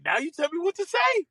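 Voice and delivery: very high mock indignation; indignant comeback. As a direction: fire back in a high, incredulous pitch. Caddie snaps back, retold.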